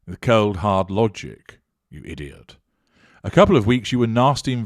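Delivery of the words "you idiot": muttered insult. Real, not acted.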